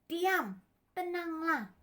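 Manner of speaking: high, falling call; commanding. Acted. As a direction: order the wind to settle. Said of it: "waktu Yesus bilang".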